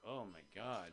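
low, hushed gasp of dismay; quiet shock. Retold or imitated. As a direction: say it under your breath. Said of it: "he's like".

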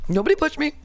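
very high, childlike mock denial; mocked Ukrainian president. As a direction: squeak it like a bullied kid denying everything. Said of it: "where the Ukrainian president said".

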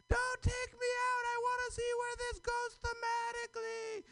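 hammed-up fangirl shrieks at an open mic; mock screaming fan. Comic mock-screams as hyped crowd.

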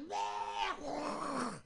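mock throw-up and gag sound; retching gag noise. Shown as a demonstration. Comedian showing how Dutch sounds, exaggerated retching gag. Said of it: "that sound you make when you throw up and gag".

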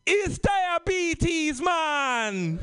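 high, drawn-out Caribbean lilt; Caribbean accent. Acted. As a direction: deliver bad news in a festive, singsong Caribbean accent. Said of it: "I had a big Caribbean accent".

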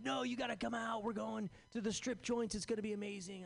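high, eager bro pitch; pushy buddy. Retold or imitated. Friend pleading to go to strip joints, high and eager. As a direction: sell the night out like it's huge.

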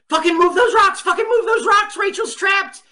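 high-pitched, frantic yelled order; yelling desperately to clear the rocks; furious shout. Retold or imitated. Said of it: "and Marin is like".

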